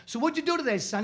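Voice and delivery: high, loud joke father voice; father character. Acted. Father questions son at supper, high and loud. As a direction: voice the dad at the supper table.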